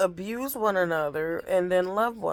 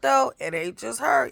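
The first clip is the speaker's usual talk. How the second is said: ordinary talk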